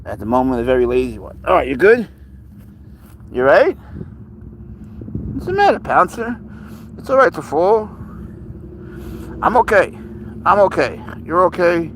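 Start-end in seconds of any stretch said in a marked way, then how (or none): 1.4-11.9 high, coaxing comfort to a startled cat; soothing a scared pet. Real, not acted. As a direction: reassure him gently, coaxing. Comforting cat after fall.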